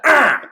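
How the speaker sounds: short, loud burst of growl; quick high growling yelp; imitated growl. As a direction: mimic the roar in one sudden blast. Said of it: "in that growl".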